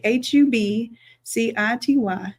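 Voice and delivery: usual speaking voice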